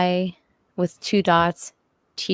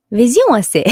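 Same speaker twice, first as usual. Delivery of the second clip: higher, louder mock French; French accent; 5 semitones higher, 6 dB louder than usual. S1 imitating the French for the stream. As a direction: Vision S A in a playful French accent. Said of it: "You gotta get the accent down".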